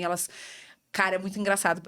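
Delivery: ordinary talk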